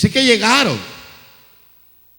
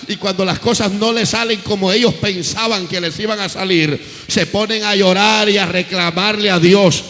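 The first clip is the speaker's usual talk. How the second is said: normal talking voice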